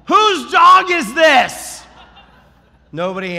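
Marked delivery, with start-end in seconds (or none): 0.1-1.8 high, loud, drawn-out yell. Retold or imitated. Dog walker calling out for an unseen owner, yelled. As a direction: holler it across the neighborhood. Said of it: "so I just yelled".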